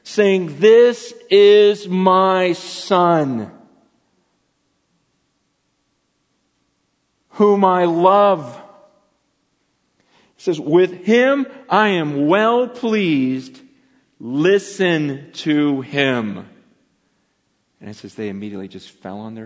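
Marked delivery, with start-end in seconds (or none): 0.6-8.6 very slow, louder, booming pronouncement; voice of God from the cloud. Acted. Preacher voicing God from the cloud, slow and booming. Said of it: "they hear a voice come out of the cloud". 10.6-16.5 slow, solemn divine pronouncement; voice of God. Acted. Preacher voicing God to the disciples, slow and solemn. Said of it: "they hear a voice come out of the cloud".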